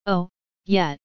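strained, effortful call. A narrator's reading. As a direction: push it out through the strain. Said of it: "called out in a strained voice".

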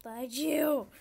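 loud, frustrated mock cursing; upset outburst. Real, not acted. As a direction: burst out at the game, upset. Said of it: "I'm really upset about these carrots".

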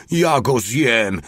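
slow, stretched acted yell; narrated roar. A narrator's reading. Soldier leaps out after the fleeing man, drawn-out mild yell. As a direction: draw each word out in a put-on holler. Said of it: "wrzasnął Mały".

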